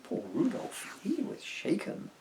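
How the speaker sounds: ordinary delivery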